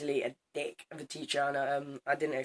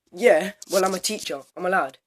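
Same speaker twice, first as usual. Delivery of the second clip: loud snooty mock teacher; 4 semitones higher, 9 dB louder than usual. Imitating the teacher's smug claim of authority, loud and mocking. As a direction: parody her smug reply. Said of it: "she's like".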